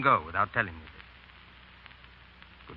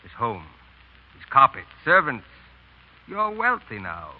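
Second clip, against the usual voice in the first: normal talking voice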